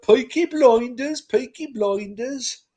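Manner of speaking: high, loud Brummie Peaky Blinders voice; Midlands accent. Retold or imitated. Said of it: "my Midlands accent".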